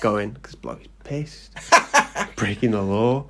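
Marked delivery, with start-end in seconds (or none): 0.4-1.5 whispered, much softer snide aside; sneering whisper. Retold or imitated. Harry sneering about Hagrid, whispered. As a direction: whisper it behind his back.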